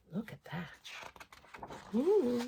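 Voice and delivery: slow, breathy-soft whisper; hushed whisper. Acted. Reader whispering to a child about a spooky picture. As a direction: point it out in secret.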